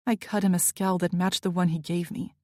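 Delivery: ordinary delivery, nothing marked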